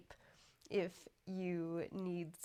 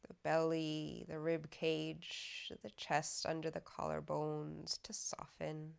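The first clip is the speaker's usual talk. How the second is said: guided meditation; 4 dB louder than usual. Acted. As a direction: guide them unhurriedly into softening, evenly and calmly. Leads a body scan meditation.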